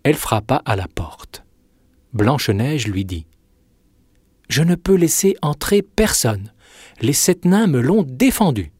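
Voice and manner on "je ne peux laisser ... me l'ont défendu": put-on higher, girlish storybook voice; raised-pitch innocent girl. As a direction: refuse as an obedient little princess.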